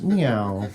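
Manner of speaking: low, drooping "meow"; short mournful imitated cat cry; sad cat meow. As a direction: mew it glumly, sinking in pitch. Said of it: "here's the sound of a sad cat".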